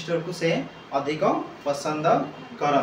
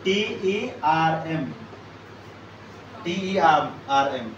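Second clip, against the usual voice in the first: slow spelling. Shown as a demonstration. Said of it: "ward ଟା କଣ ଦେଖିବ ଏଥିରେ".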